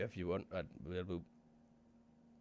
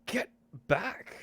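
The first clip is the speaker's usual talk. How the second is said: higher, louder sharp command; shout; 13 semitones higher, 7 dB louder than usual. Acted. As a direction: snap the order at him. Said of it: "turns and shouts at him".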